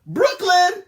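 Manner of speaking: loud high call. Retold or imitated. Calling Brooklyn upstairs, a loud high shout. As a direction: yell her name across the house.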